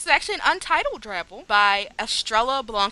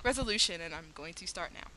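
ordinary talk

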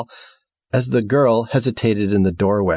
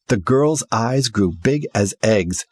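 usual speaking voice

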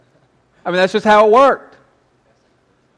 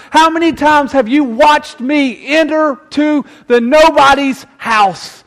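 higher, louder rebuke of the disciples; indignant Jesus; 9 semitones higher, 4 dB louder than usual. Acted. Jesus rebuking his disciples, indignant and loud. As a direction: scold them, how many times must I show you. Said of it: "Jesus becomes indignant and says".

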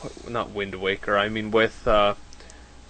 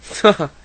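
laughing; 7 semitones higher, 5 dB louder than usual. Real, not acted. Laughs at Nintendo joke.

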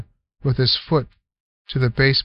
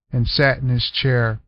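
ordinary delivery

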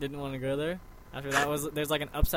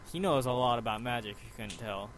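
usual speaking voice

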